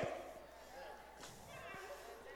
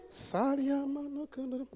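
meek quiet praying; 19 dB quieter, slower than usual. Shown as a demonstration. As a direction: barely voice it, mocking timid prayer. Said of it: "you don't say".

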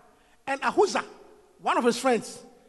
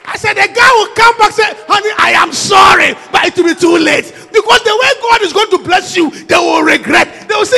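shouted, high sermon climax; preaching at full force; 6 semitones higher, 12 dB louder than usual. Real, not acted. Preacher shouting to the congregation, full force. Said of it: "I'm preaching".

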